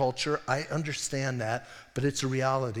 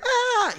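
sudden high, loud "ah!"; mock scream of fear; 7 dB louder than usual. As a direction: yelp as if terrified, just for show.

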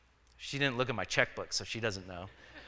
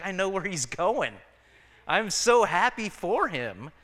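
higher, joyful retold reply; happy reassurance; 6 semitones higher than usual. Answering a sister about their dying dad, happily assured. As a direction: lift the pitch, drawing out the joy.

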